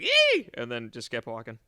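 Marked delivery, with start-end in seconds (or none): 0.0-0.5 very high, loud mock squeal; imitated startled squeal. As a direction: let out a retold squeal of fright. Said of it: "and I was like".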